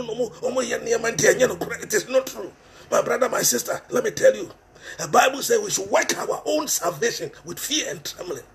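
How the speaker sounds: normal talking voice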